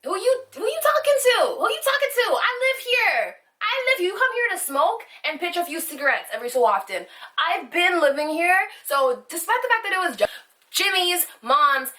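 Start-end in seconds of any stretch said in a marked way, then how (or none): 0.0-8.7 higher, indignant comeback; indignant retort. Retold or imitated. Telling the cigarette guy she lives there, higher indignant retort. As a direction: fire back like he's the intruder. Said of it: "I was just like".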